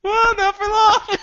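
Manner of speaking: squeaky high mock threat; mock menace. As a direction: tease it in a tiny high voice. Menacing joke to player.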